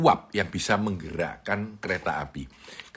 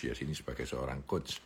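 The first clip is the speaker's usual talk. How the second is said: fading repeat; 6 semitones lower, 10 dB quieter than usual. Shown as a demonstration. Speaker repeats the phrase to the audience, fading much softer. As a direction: repeat sebagai seorang coach much softer and lower.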